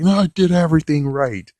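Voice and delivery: loud, high protest; indignant robot yelling he did it right; indignant shout. Retold or imitated. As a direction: snap back defensively. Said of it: "Red Body's like".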